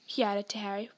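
usual speaking voice

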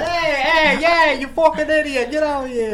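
put-on high, loud New York tough-guy accent; Joe Pesci. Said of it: "that was a great Joe Pesci".

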